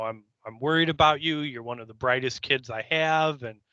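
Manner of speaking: ordinary delivery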